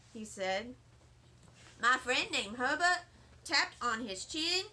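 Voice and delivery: plain reading voice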